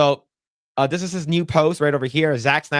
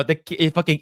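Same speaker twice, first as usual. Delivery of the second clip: ordinary delivery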